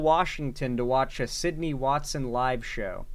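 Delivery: ordinary delivery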